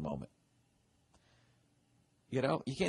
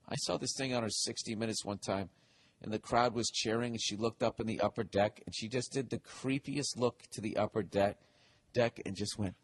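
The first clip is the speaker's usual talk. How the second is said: usual speaking voice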